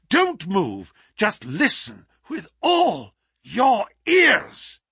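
high, loud, urgent commands; sudden urgent strength. A narrator's reading. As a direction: bark orders in a sudden burst of strength. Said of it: "his sudden access of strength".

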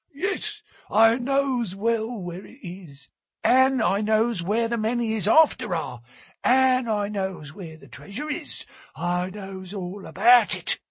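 put-on high, cracked old seaman, sure of himself; an old salt who knows the secret; stubborn old sailor. A narrator's reading. Old sailor tells the narrator he knows where the boat is, stubborn.

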